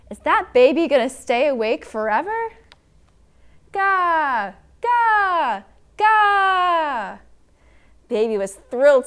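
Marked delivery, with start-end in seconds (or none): 0.1-2.5 raised, higher-pitched storybook yell; yelling neighbor. A narrator's reading. Neighbor complaining about a baby still awake, yelling. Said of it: "neighbor yelled". 3.7-7.2 put-on loud high drawn-out baby "gah"; baby's wailing song. A narrator's reading. Baby serenading the yelling neighbor, loud wailing. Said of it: "continued singing the song of her people".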